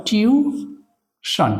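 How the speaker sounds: Tuition very slowly, split as টিউ, শন; syllable by syllable. Shown as a demonstration. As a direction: break tuition in two for spelling. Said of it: "syllable ভাগ করে এটাকে যদি আমরা উচ্চারণ করি".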